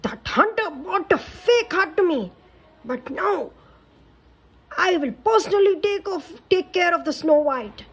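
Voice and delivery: high, fierce queen voice; wicked queen. Acted. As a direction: spit it out like a jealous queen. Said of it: "she exclaimed".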